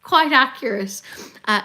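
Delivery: normal talking voice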